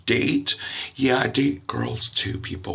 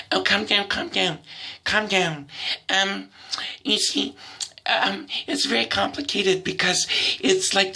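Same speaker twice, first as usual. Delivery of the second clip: high, patronising soothing voice; condescending girl; 9 semitones higher than usual. Retold or imitated. Other girl calming the comedian, high patronising tone. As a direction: talk down sweetly, pitched high.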